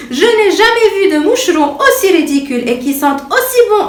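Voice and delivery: high, laughing mockery; mock her while still laughing; laughing through words. A narrator's reading. The city fly mocks Patouche, laughing high. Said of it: "elle éclata de rire".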